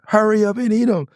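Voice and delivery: urgenza da scena comica, più acuto; urgent hurry. Retold or imitated. Urgent line from the song.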